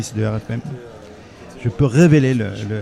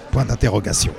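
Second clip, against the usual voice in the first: normal talking voice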